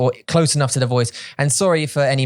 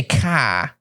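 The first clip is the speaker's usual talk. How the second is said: Boston car. Shown as a demonstration. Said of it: "like, they say".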